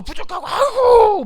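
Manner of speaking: high, drawn-out exaggerated lament; mock wailing. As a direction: wail it like a hammed-up complainer. Acts out people lamenting their poverty. (과장되게 길게 뽑는 한탄)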